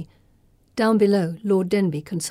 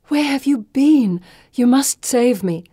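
insistent plea; 6 semitones higher, 6 dB louder than usual. A narrator's reading. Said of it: "Felicity insists".

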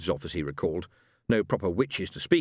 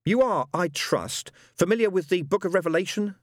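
Aziraphale's voice; 10 semitones higher, 3 dB louder than usual. A narrator's reading. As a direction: ask it like a patient schoolmaster. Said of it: "with Aziraphale's voice".

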